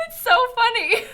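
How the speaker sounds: high, loud laugh-choked words. Real, not acted. Streamer reacting to her own possum story, laughing. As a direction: say it while cracking up.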